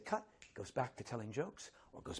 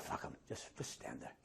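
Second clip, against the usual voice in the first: hushed Hopkins advice; 12 semitones lower, 9 dB quieter than usual. Retold or imitated. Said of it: "He said".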